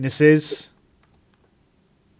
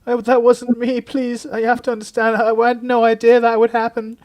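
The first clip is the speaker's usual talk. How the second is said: high, louder pleading, voice near breaking; pleading in tears; 11 semitones higher, 5 dB louder than usual. Acted. Celia breaking down in front of the inspector, high tearful pleading. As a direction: beg through mock tears.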